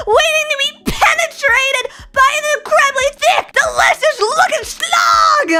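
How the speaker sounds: hammed-up fury over bent spines; mock outraged shout. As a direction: yell it like a tragic announcement.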